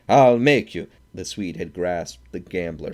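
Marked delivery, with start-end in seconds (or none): 0.1-0.9 loud, high, full yell; furious yell. A narrator's reading. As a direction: scream the threat as you lunge. Threat before attack.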